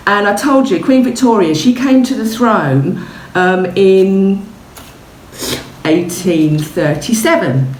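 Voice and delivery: usual speaking voice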